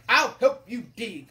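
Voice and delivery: loud, declamatory royal voice; hungry king. Acted. Hungry king offers to dig, booming voice. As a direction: announce help grandly.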